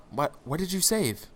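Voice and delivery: put-on high, puzzled old mage voice; the Magus questioning the raving Vago, quizzically. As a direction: ask it like a baffled wizard. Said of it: "inquisitively, or quizzically".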